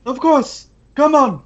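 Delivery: high-pitched goblin voice; squeaky, insistent little crime boss; excitable goblin boss. Acted. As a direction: pitch it way up, indignant. Game master voices goblin boss.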